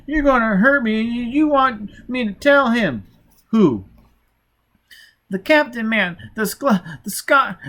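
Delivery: put-on high, loud wailing child voice. A narrator's reading. Child fumbling the word, wailing high and loud. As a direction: wail it like a terrified little boy. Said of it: "wailing louder".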